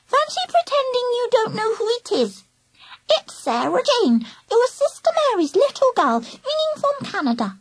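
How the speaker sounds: put-on high warbling toddler voice; babyish, sing-song squeak with a lisp; lisping three-year-old. A narrator's reading. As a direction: warble it like a lisping little child. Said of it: "lisping like a three-year-old".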